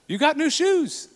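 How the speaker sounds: high, approving coo; delighted tone. Shown as a demonstration. Dad showing daughters good tone, delighted. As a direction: admire them in a high, pleased voice. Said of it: "Sounds really good".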